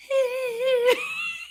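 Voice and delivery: high yelled war cry breaking into laughter; laughing war cry. Retold or imitated. A boxer answers her coach's demand with a laughing high war cry. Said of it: "give me a war cry".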